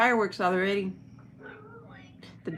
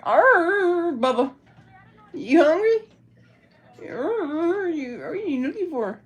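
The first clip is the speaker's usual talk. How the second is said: high, louder little dog voice; dog's voice; 9 semitones higher, 5 dB louder, slower than usual. Pretend. Owner voicing her hungry dog asking for food. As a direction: play the hungry dog talking.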